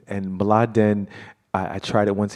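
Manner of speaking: ordinary delivery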